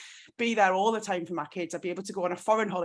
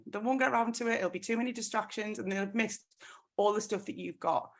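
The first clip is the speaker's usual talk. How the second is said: usual speaking voice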